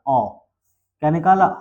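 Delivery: normal talking voice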